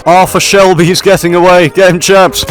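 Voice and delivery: loud, high call to the troops; rallying shout. Acted. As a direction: shout the order across the battlefield. Calling troops to chase deserter.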